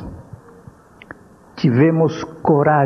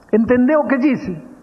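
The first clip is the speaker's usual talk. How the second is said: stern mother; 8 semitones higher than usual. Retold or imitated. Mother questioning son.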